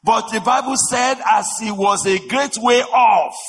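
high, loud impassioned preaching; preaching at full force. Acted. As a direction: drive the story home from the pulpit.

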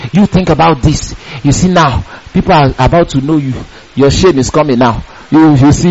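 low, insinuating devil's voice; lightly voiced tempter, pitched low; devil's accusing thoughts. Devil plants shameful thoughts in a sleepless person, insinuating. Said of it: "devil will be coming with thoughts".

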